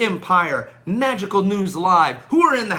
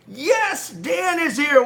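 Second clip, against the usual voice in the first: excited shout; 9 semitones higher, much slower than usual. Real, not acted. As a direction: call out Dan's name with delight. Greeting a viewer on live stream.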